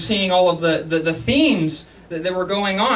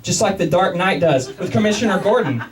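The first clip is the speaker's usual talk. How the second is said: ordinary talk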